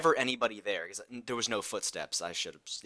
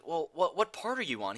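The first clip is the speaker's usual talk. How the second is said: higher, nervous; wary question; 6 semitones higher than usual. Retold or imitated. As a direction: ask warily. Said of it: "I'm like".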